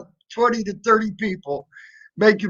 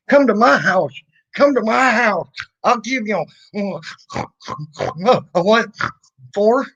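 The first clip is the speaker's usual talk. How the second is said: raised-pitch mock challenge; blustering idiot; 3 semitones higher than usual. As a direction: play an idiot issuing a challenge. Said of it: "I could be an idiot and say".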